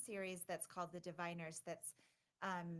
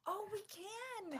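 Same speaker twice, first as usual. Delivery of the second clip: very high, drawn-out realization; slow, high-pitched real surprise; delighted realization; 14 semitones higher, slower than usual. Real, not acted. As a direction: stretch it out high as it dawns on you. Realizes the news can be announced.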